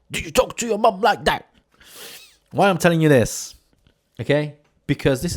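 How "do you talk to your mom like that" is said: high, mock scandalized stranger; indignant bystander. Offended bystander scolding him in the shop, high mock outrage. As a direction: play the shocked Brit. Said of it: "He said".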